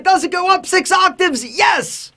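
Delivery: squeaky excited high pitch. Shown as a demonstration. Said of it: "When I get super excited".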